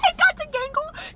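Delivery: high, slow, strained crying voice; voice breaking. Real, not acted. Vlogger remembering Gangle, voice breaking high. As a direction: let the words crack into tears. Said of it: "the tears just came out".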